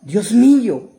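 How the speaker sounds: anguished shout in prayer; crying out to God. Acted. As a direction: shout it out like a plea to heaven. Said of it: "él está gritando".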